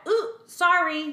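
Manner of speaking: reflexive sorry. Retold or imitated. Speaker showing her reflex apology, chirpy and high. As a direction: fire off an apology on instinct. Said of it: "I do that".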